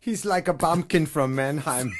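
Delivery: put-on comic German accent; mock German accent. Prankster mocking his friend to the girls, comic German accent. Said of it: "I would translate for him".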